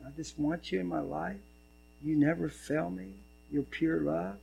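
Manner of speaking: very soft, low retold prayer; broken plea. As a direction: imitate a humbled prayer, hushed and tender. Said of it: "she just broke and asked him".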